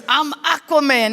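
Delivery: slow, loud kid playing superhero; mock superhero boast. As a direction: proclaim it like a boy hero, drawn out. Brother playing superhero in his underoos.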